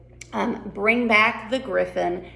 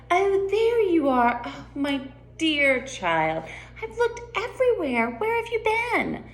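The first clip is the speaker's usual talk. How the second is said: gushing Duchess; 9 semitones higher than usual. Acted. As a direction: play a high-voiced, fussing Duchess. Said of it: "Duchess says".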